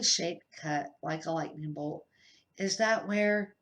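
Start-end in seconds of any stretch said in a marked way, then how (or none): none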